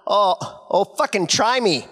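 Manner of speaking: put-on Canadian accent. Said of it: "she's got the Canadian".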